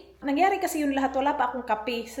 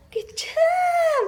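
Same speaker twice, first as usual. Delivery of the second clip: very high, loud squealed cheer; delighted praise; 14 semitones higher, 5 dB louder than usual. Real, not acted. Praising the child. (matinis na tuwang-tuwang papuri)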